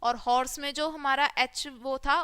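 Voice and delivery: ordinary delivery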